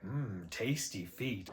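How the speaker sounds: lightly voiced slow, quiet savouring; a low-key, drawn-out imitation of relishing food; Minoko impression. Player quoting a game character's lines about feet, slow soft imitation. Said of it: "All of Minoko's lines about feet".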